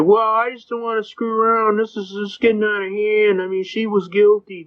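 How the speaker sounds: hoog en geprikkeld klagend; de agent klaagt bij de rechter, hoge stem; whiny cop. Retold or imitated. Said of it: "He said".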